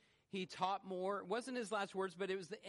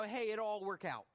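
breezy, mock-casual brush-off; too casual; 4 semitones higher than usual. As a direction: toss it off as if nothing could go wrong. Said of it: "Or was he too casual?".

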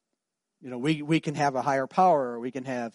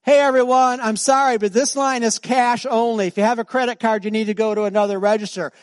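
raised high, loud call to the whole queue; loud announcement to the line; 8 semitones higher, 8 dB louder than usual. Retold or imitated. Cashier announces cash only to the queue, loud and high. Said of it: "and she goes".

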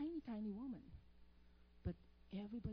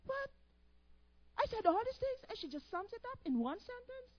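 incredulous; 10 semitones higher, 5 dB louder than usual. Retold or imitated. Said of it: "And he's like".

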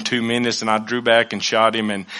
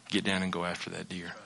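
much softer, low, calm command; God's inner voice; 5 semitones lower, 15 dB quieter than usual. Retold or imitated. God speaking to the hunter in his spirit, soft and low. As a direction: hush it like a voice inside your head. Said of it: "in my spirit went".

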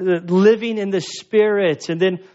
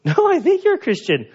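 reassuring reply; 10 semitones higher, faster than usual. Retold or imitated. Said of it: "It's like".

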